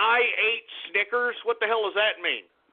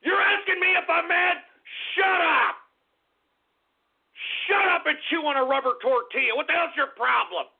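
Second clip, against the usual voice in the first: higher, raised angry retort; angry outburst; 6 semitones higher than usual. Real, not acted. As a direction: snap back at the chat. Chat asked if he's mad.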